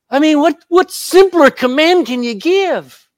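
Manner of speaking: exasperated. Real, not acted. Preacher's exasperated outburst.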